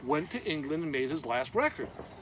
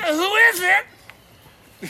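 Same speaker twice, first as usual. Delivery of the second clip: high, loud, very slow woman calling through a door; woman's voice from inside; 14 semitones higher, 11 dB louder, much slower than usual. Retold or imitated. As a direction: call out like a woman hidden inside a parked car. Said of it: "from inside a woman's voice is".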